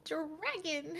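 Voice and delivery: high, drawn-out dragon call; playful game-voice cry. Acted. Co-host repeating the Spyro bit, playful high cry. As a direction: stretch the word out high, in play.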